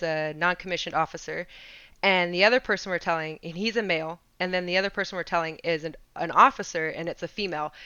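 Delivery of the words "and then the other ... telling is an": ordinary delivery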